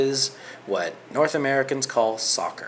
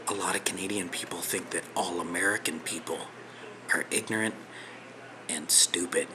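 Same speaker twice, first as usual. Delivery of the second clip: low, soft, near-whispered aside; hushed confession; 4 semitones lower, 7 dB quieter than usual. Real, not acted. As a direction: lower your voice as if confiding something impolite. Confiding an impolite stereotype.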